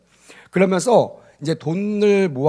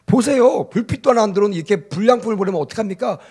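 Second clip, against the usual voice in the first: raised, high-pitched customer complaint, fast; acted angry yelling; 5 semitones higher, 4 dB louder than usual. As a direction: act out an irate customer shouting. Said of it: "소리를 막 지르니까". (화가 나서 소리치는 고객 흉내)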